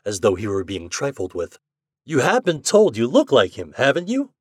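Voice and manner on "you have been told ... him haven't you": hurt insistence. Acted. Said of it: "Ford insisted in hurt tones".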